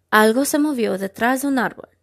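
usual speaking voice

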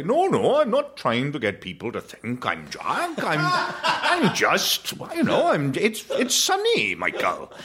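high, slow, stretched-out protest; dad's posh Welsh voice. Retold or imitated. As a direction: imitate a posh Welsh dad feigning innocence. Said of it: "and he'd say".

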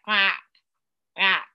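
loud mock quack; quacking duck. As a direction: quack like a toddler would. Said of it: "And he quacks".

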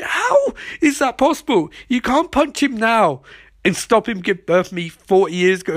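squeaky, indignant mock questioning; mock incredulity. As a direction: object in hammed-up bafflement. Acted incredulous objection to threat.

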